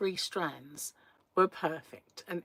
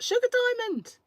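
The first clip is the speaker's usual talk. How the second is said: ordinary talk, nothing marked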